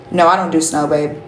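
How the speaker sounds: ordinary talk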